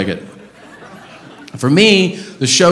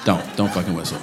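low, soft warning; quiet warning; 7 semitones lower, 9 dB quieter than usual. Real, not acted. Comic to the whistling audience member, low quiet warning. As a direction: drop low and warn them softly.

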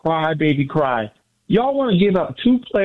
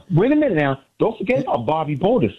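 ordinary talk, nothing marked

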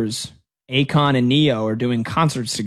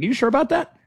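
skeptical challenge; 9 semitones higher than usual. Retold or imitated. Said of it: "I was like".

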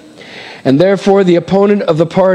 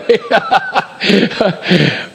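laugh; 2 semitones higher than usual. Real, not acted. Pastor chuckling to the congregation after his joke.

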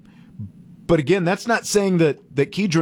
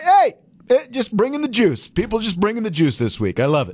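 higher-pitched, mildly sarcastic hype; a little sarcastic; 7 semitones higher than usual. Acted. Radio host hyping fired-up fans to his co-host, a little sarcastic. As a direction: start high on a tongue-in-cheek "hey", then ease down. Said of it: "I'm being a little sarcastic".

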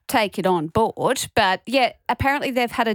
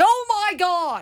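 retold shock; 9 semitones higher than usual. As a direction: replay your shocked reaction, pitched high. Acting out her shocked reaction.

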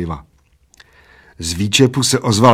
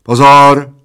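very loud starter's call; shouted ready command; 4 semitones higher, 11 dB louder than usual. A narrator's reading. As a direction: call it out long and high. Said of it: "zavelel plešatec".

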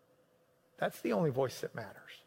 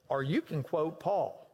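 ordinary delivery

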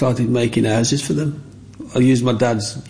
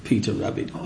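normal talking voice

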